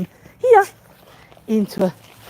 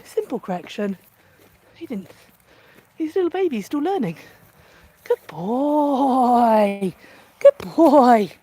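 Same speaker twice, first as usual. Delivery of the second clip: ordinary delivery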